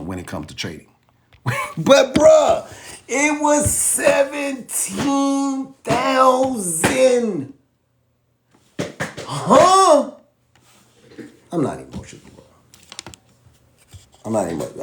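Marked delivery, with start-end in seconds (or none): 1.8-10.1 high, stretched, slow wail of disbelief. Real, not acted. Creator admitting the seventeen thousand loss, high stretched disbelief. 11.5-14.8 lower, softer mock-calm denial; deadpan denial. Creator insisting to viewers he's calm, deadpan mock denial. Ironic denial after outburst.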